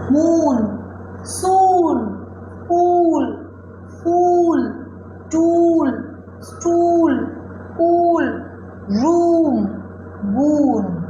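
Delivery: loud word list, every oo in "मून", "पूल", "रूम" held long; "स्टूल", "फूल", "टूल" drawn out loudly, one by one; long oo drill. Shown as a demonstration. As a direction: chant each word like "सून" with a stretched oo. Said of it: "ये है long sound ऊ".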